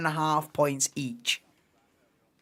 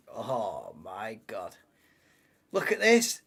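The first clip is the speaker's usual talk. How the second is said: ordinary talk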